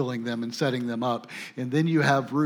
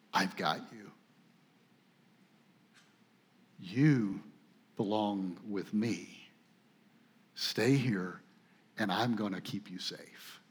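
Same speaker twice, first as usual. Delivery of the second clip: softer and lower, gentle promise of safety; reassuring; 6 semitones lower, 10 dB quieter than usual. Retold or imitated. Boaz promising Ruth protection, a soft lowered reassuring voice. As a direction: hold her close with the words.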